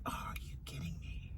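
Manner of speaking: breathy whisper, voice dropped out; whispered disbelief. Retold or imitated. Pet mom whispering at the side-eyeing dog, hushed disbelief. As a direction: hiss it under your breath in disbelief. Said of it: "I'm like".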